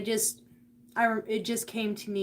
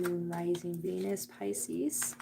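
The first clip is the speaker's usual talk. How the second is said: ordinary talk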